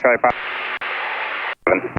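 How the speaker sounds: clipped radio fragments dropping out; radio breaking up. Real, not acted. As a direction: signal cutting in and out. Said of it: "Broke up".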